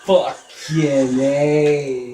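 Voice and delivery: loud, high cry of shock, stretched out; real shocked outburst. Real, not acted. Player seeing a gory game death, shocked yelling. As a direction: yell it in horrified shock, drawing out the words. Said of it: "still shocked of seeing that".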